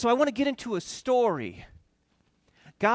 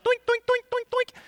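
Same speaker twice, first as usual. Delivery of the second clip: cartoon doinks. God knocking down Egyptian gods.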